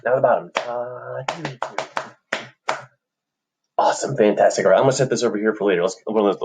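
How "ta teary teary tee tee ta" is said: soft, voiceless rhythm syllables; whispered rhythm. Shown as a demonstration. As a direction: count the beat under your breath. Practice run, voice under the tapping.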